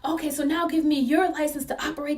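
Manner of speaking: high-pitched, accusing interrogation; hammed-up indignant officer demands; mock yelling officer. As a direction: imitate a cop scolding, pitched well up. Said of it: "So she's yelling at the owners of the stand".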